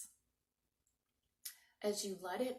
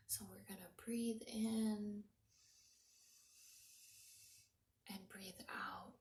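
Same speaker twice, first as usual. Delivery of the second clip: soft breathy whisper, guiding the breath; 3 dB quieter than usual. Real, not acted. Yoga teacher guiding children's breathing, whispered. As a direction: hush it like a calm yoga teacher.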